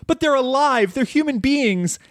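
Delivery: put-on young Charlotte calling from off screen, pleading and high. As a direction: pitch it way up for the girl. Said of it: "you just hear Charlotte off screen go".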